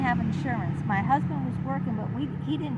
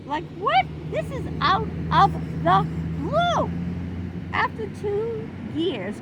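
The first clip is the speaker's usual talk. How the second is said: high, louder, slower indignation; outraged disbelief; 10 semitones higher, 6 dB louder, slower than usual. Real, not acted. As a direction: stretch each word in disbelief. Outraged at abrupt dismissal.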